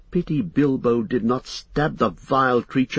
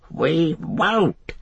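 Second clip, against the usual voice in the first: high, defiant creature cry; 8 semitones higher than usual. A narrator's reading. Gollum shaking his fist east, crying out. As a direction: cry out in refusal. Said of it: "he cried".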